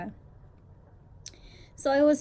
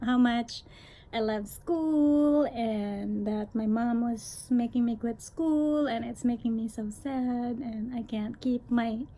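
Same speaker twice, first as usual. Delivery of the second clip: ordinary talk, nothing marked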